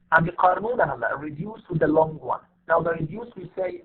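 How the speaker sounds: ordinary talk, nothing marked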